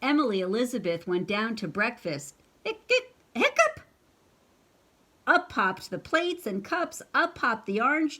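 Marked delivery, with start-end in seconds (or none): 2.6-3.8 high rising cartoon hiccup; hammed-up hiccup. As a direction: squeak it up into a hiccup. Clifford's hiccup again.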